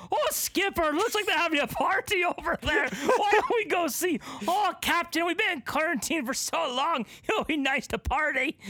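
high, eager party-hungry sailor; giddy sailor voice. Pretend. Captain calls to skipper about the beach party, high and eager. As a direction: squeal it like a captain itching to party. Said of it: "someone on the ship will say".

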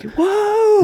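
high mock scream; one high, imitated yell; retold screaming. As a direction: imitate a player screaming in someone's face. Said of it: "Like, screaming at him".

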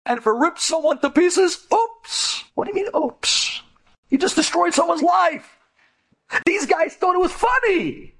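high, sing-song mock of careless bullies. Preacher mocking bullies who shrugged off harm, high mocking voice. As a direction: sneer at their casual cruelty.

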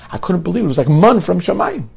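high, excited disbelief; gushing, amazed young man. Acted. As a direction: sound like you still can't believe it. Young man marvels at the gift.

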